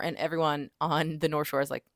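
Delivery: normal talking voice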